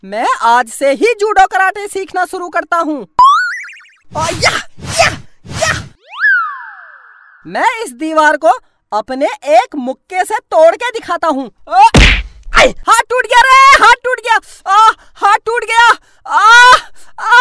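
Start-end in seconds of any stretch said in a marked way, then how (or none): 3.0-5.7 sharp high martial arts cries; karate yells. Acted. Karate shouts while practising. 12.5-17.3 comic crying over a broken hand; hammed-up pain wail. Hits wall, hand hurts.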